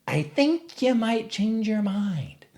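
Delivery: high, drawn-out threatening dog voice; menacing dog. Retold or imitated. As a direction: stretch it out slowly, high and strange. Retold dog menacing the suspect.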